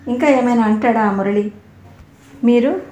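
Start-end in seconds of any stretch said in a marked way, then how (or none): 2.4-2.8 louder, flustered indignant stammer. A narrator's reading. Raji, embarrassed, flares up at her teacher. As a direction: blurt it out stung and embarrassed. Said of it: "రోషంతో ముద్దమందారంలా మారింది".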